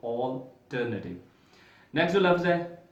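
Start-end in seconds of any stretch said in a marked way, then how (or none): 0.1-1.2 alternative very slow, "ol-TER-na-tiv"; correct pronunciation. Shown as a demonstration. Teacher to students, very slowly modelling alternative. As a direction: stretch alternative out syllable by syllable. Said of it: "alternative पढ़ा जाएगा इसको".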